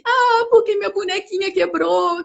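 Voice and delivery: a hint of a whiny child; child's complaint. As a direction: mimic a little girl whining about her broken doll. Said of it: "A criança diz assim".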